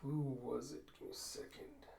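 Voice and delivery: very soft, half-whispered aside; muttering to oneself. Real, not acted. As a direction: think aloud under your breath. Game master mutters while checking notes.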